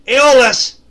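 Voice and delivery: loud, high shout from the lookout; full-volume alarm call; yelled warning. Acted. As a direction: holler it urgently across the ship. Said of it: "He yells out".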